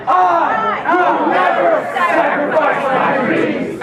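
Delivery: high, slow, loud chant; loud shouted vow. Shown as a demonstration. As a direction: shout it with the whole room. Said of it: "Stand up and say it loud".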